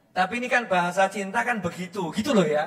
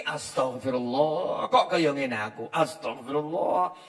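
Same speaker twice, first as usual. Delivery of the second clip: remorseful repentance; 6 semitones lower, 4 dB quieter than usual. Acted. Sinner asks forgiveness for himself, low and remorseful. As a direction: sigh regretfully at yourself.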